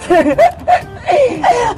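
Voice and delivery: high, gleeful giggling; high-pitched excited laughter; excited giggle. Acted. Laughing while about to open parcel.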